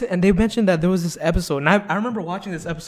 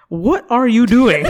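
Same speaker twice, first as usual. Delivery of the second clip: startled TV host, slow, higher and louder; Chris Hansen; 7 semitones higher, 11 dB louder, slower than usual. Retold or imitated. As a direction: catch the naked guy off guard. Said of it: "In that classic voice".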